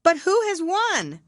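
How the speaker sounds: high, loud eager crowd voice; all ask at once, breathless; panting question. A narrator's reading. Racers crowd round the dodo, high and loud. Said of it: "panting and asking".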